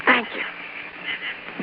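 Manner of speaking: whispered thanks to Johnny Dollar before the kiss. Acted. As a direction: breathe it close and tender. Whispered thanks as she kisses him.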